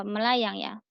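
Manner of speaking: ordinary talk, nothing marked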